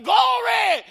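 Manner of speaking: loud, high holler of glory; praise shout. Retold or imitated. Man rejoicing alone in his truck, shouting praise. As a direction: let it burst out at full volume. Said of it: "And I'm shouting".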